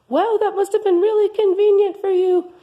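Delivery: mock gushing. Host gushing in raised voice.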